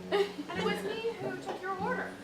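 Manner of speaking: usual speaking voice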